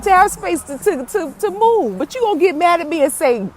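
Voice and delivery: high, worked-up telling-off; upset rant. Retold or imitated. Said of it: "I was so upset".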